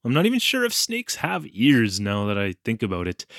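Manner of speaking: ordinary delivery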